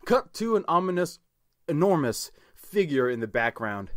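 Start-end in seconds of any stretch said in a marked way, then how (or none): none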